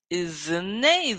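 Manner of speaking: "Is a name" slow, sounds joined; slow linking drill. Shown as a demonstration. Teacher drilling linked sounds, slow. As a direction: join "Is a name" slowly. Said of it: "We connect everything".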